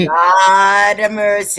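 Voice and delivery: slow, drawn-out mock Jamaican patois; a playful stab at a Jamaican patois exclamation; lightly put-on Jamaican patois, stretched out; Jamaican patois accent. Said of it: "how was that patois accent".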